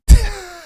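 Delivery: sudden high, loud burst of laughter; high shrieking laugh. Real, not acted. Big laugh at radio joke.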